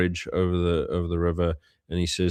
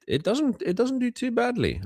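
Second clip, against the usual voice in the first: coy understatement. Real, not acted.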